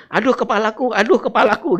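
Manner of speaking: put-on high, loud cry of a sick boy; high child's cry of pain. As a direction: wail like a child with a headache.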